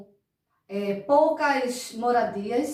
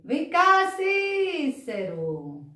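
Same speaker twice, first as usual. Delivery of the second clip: calling out; 7 semitones higher, much slower than usual. Acted. Teacher calls the puppet friend.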